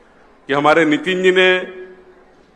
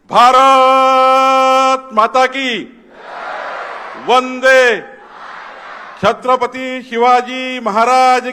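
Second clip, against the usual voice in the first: slow, drawn-out roar, much higher and louder; roaring rallying speech; 9 semitones higher, 4 dB louder, much slower than usual. Real, not acted. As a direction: bellow each chant line to fire up the crowd. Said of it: "असा कसा आवाज चालणार आहे?".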